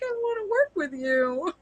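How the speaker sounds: high, mock-whiny plea; pleading. Envious crafter begging women at bigger dye companies, mock pleading. Acting out longing for a team.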